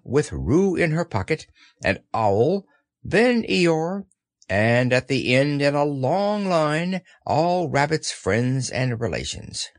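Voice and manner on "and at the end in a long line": ordinary delivery, nothing marked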